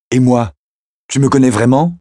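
high, insistent question; abrupt, challenging question; pointed challenge. Acted. Abruptly challenges him about herself.

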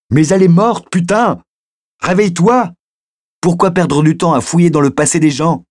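high, loud, furious outburst; frustrated yelling, pitched right up; exasperated shouting. Acted. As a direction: yell to shake him to his senses. Shakes him roughly, swearing.

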